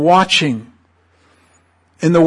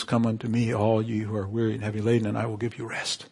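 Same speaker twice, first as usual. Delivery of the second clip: soft, low, fading reassurance; gentle invitation; 3 semitones lower, 11 dB quieter, faster than usual. Acted. Jesus inviting the weary to rest, gently. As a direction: quietly offer comfort, dropping away at the end.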